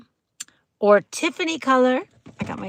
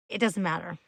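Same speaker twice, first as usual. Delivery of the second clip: normal talking voice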